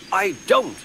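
high, loud, insistent contradiction; heated denial. A narrator's reading. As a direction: deny it harder, pitched right up. Quarrel of denial, second round.

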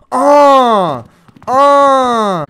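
startled cries; scared yelps. Real, not acted. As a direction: yelp in shock. Jump scare in horror game.

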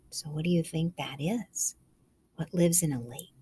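soft whispered question; whisper, drawing them in. Real, not acted.

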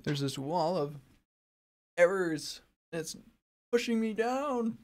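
high, slow, hammed-up distress; silly melodrama. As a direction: overplay being crushed by errors. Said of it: "I'm just being silly".

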